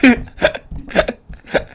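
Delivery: unvoiced high giggle at own blunder; airy embarrassed giggle. Real, not acted. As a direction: laugh it off, embarrassed. Laughing at tech mishap.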